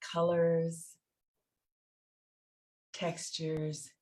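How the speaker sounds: soft, spacious meditation voice with long pauses; guided meditation. Real, not acted. Teacher guiding listeners through loving kindness practice, guided meditation. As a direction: guide them inward phrase by phrase, letting each one settle.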